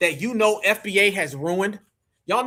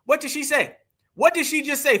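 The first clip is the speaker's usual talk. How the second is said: ordinary delivery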